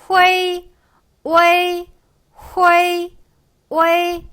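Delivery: 후에이, 우에이 long on a high level tone; loud, high alternating 후에이 and 우에이; syllable drill. Shown as a demonstration. Teacher drilling both forms for learners, syllable drill.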